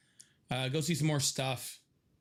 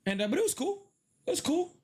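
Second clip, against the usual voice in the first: higher, lifted and eager; excited; 13 semitones higher, faster than usual. Real, not acted. Telling viewers about his Dublin trip, suddenly higher and eager. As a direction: perk right up about the trip.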